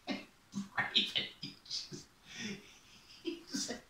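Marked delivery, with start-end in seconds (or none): none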